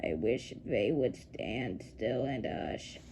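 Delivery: low, soft, slow grumble; quiet irritated mutter. A narrator's reading. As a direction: keep grumbling under your breath.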